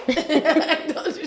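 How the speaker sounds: slow, high laughing "I told you"; giggling repeat of the punchline; laughing echo. Real, not acted. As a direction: drag it out through laughter. Laughing echo of friend's line.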